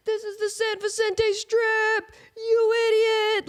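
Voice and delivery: high, loud, slow jeering crowd voice; mock heckler. Imagined crowd booing her over the Sunset Strip, mock jeering shout. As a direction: boo her like an angry know-it-all fan.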